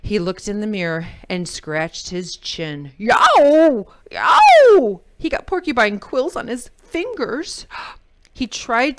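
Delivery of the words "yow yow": loud, high yelping pain; pained yelps. A narrator's reading. Jim pricked by porcupine quills, loud high yelps. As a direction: yelp as the quills stab.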